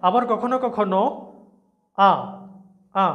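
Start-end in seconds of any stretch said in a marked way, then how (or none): 2.0-3.1 আ said as a short clipped ah. Shown as a demonstration. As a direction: clip আ short like in cup. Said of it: "এরকম হয়, short sound". (ছোট আ ধ্বনি)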